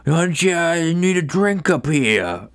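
put-on slow, high, slurred drunk voice. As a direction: drag the words out like a tipsy singer. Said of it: "he's like".

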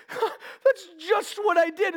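high, slow, tearful imitation; emotional. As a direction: act out a choked-up realization. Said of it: "if he's getting emotional".

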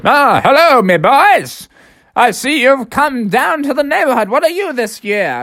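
high, lilting storybook greeting; hearty rabbit dentist. Acted. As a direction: welcome the boys in a high character voice.

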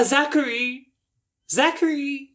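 acted mum summoning her son; mother calling out. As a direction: call your kid from the front door. Mother calls child to door.

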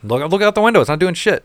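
man scoffing at his family over the storm warning, exasperated. Retold or imitated. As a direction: point at the window, annoyed.

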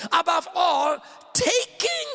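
slow, high, emphatic preaching; declaimed scripture. Real, not acted. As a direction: stretch the key words with ringing force.

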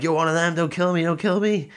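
high-pitched panicky begging, imitated; mock crying plea. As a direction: plead for your life in a put-on whimper. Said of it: "starts crying and everything".

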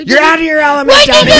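loud, high put-on voice; movie quote voice. Mocking friends for the bird movie, a loud put-on quote. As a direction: deliver it like a famous film line.

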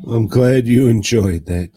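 half-teasing loud congratulations; loud mock praise. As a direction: call it out loudly to Joe. Repeats line louder to Joe.

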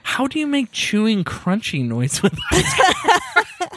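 high, slow, laughing question; laughing disbelief. Real, not acted. Laughing disbelief at chewing noise.

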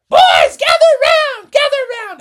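very high, loud call to gather round; calling out shrilly. Acted. Team mom calling the boys over before warm-ups, loud and high. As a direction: summon the boys at the top of her voice.

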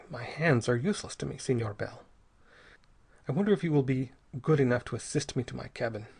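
usual speaking voice

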